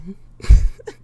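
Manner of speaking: loud short burst of laughter; laughing. Real, not acted. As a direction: crack up at the teasing. Laughs at chat's joke answer.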